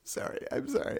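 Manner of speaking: high, soft, tearful squeak; choked up. Acted. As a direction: squeeze out an apology through welling tears. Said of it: "I'm getting a little choked up".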